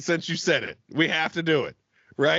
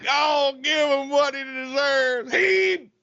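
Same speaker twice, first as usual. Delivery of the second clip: movie quote voice; 9 semitones higher, much slower than usual. Acted. Quoting a movie character.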